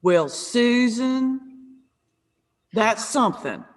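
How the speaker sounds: long, drawn-out Southern mother voice; thick Southern accent. Retold or imitated. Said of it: "My mom in this very thick Southern accent".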